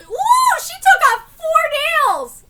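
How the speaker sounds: high, loud shocked exclaim; horrified cry. Real, not acted. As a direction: recoil in horror. Horrified reaction.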